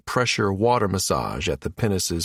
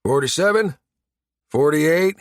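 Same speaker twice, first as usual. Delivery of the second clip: higher, questioning count; technician calling out readings; 6 semitones higher, 4 dB louder than usual. A narrator's reading. Technician calling readings to his unseen partner, raised voice. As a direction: call out each number to a partner. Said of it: "busy calling out readings to his unnamed partner".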